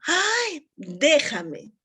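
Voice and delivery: a sudden high "Ay", then a playful shove-off "déjame"; pretend flirty brush-off.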